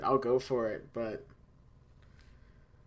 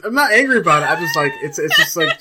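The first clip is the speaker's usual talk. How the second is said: laughing defensively; 4 semitones higher, 11 dB louder than usual. Real, not acted. Defensive about the rant.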